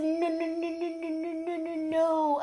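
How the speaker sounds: high, tearful, pleading string of no's; retold crying plea. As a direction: beg through tears, the last no's slowed way down. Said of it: "I'm crying".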